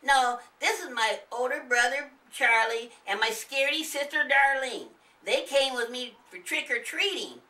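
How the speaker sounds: put-on high, loud child calling out; a small boy waking an old man; little boy voice. Nathan greeting and waking the old neighbour, high and loud. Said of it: "Nathan stated".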